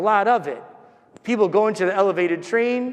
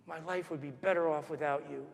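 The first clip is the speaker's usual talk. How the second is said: very soft retold insult; hushed echo; 3 semitones lower, 11 dB quieter than usual. Boy hears his mother ahead of him, hushed echo. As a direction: replay the cruel words under the voice.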